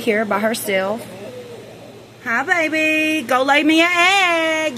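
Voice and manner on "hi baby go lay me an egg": slow, high coaxing; pet talk. Real, not acted. Owner coaxing a hen to lay, slow and high.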